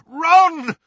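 loud, high shout of a name. Acted. Harry calling out to mesmerized Ron, shouting. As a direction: shout it out sharply. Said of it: "he shouted".

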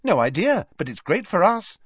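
high, loud, bright reply. A narrator's reading. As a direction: chirp it cheerfully. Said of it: "said Ginny brightly".

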